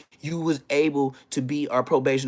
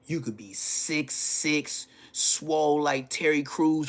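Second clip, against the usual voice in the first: ordinary talk, nothing marked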